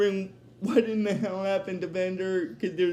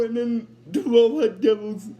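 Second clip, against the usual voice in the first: ordinary talk, nothing marked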